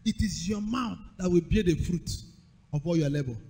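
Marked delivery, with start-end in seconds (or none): none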